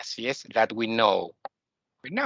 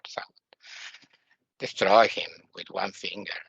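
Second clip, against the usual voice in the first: ordinary delivery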